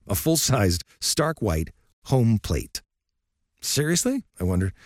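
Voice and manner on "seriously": high, disbelieving "Seriously?"; incredulous. Retold or imitated. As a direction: ask it in pure disbelief. Narrator's incredulous inner thought.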